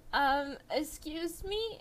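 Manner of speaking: put-on high, slow, hesitant old-lady voice; a lightly voiced worried old woman; timid old lady. Old lady timidly stopping the cashier, high and slow.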